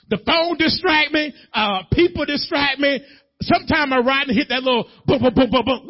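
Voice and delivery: high, loud, animated testimony; full-force preaching. Real, not acted. Preacher confessing to distracted driving, fired-up preaching. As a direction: pound out the list of distractions.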